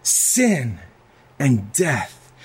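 a lightly voiced Jesus; Jesus telling his people he saved them; Jesus speaking. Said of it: "he said".

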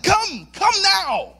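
loud, high, drawn-out dog command; exasperated master calling his dog; frustrated command. Retold or imitated. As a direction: order the puppy over, losing patience. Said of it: "I'm getting frustrated".

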